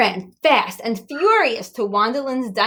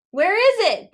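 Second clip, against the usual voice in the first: begging; 12 semitones higher, 4 dB louder than usual. A narrator's reading. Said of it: "begged Stella".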